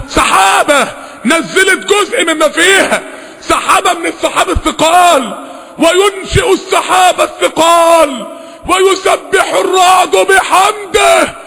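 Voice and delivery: high, slow, ringing preaching; fervent pulpit delivery, pitched high; impassioned recitation. Real, not acted. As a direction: build the verse to a soaring, drawn-out peak. Preaches and recites with rising force.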